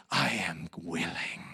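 slow, low whisper; acted whisper, drawn out; tender assent. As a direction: drop to a hushed, slow promise. Acting Jesus's tender answer.

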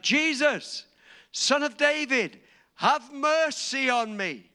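loud, high, slow cry for mercy; shouting plea. Retold or imitated. Blind Bartimaeus calling to Jesus as he passes, in a loud pleading shout. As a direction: call out over the crowd, pleading. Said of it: "he shouted".

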